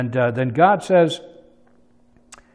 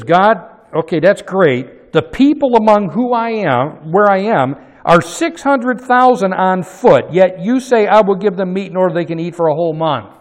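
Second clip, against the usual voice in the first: exasperated protest; 5 semitones higher, 7 dB louder than usual. Retold or imitated. Moses protesting to God.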